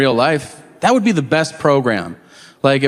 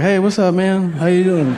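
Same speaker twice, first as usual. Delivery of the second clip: nervous greeting; 4 semitones higher than usual. Retold or imitated. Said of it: "I was like".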